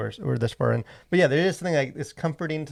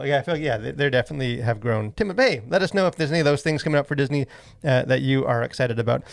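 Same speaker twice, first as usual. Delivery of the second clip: ordinary talk, nothing marked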